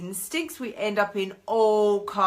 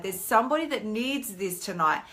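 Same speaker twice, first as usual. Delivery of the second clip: usual speaking voice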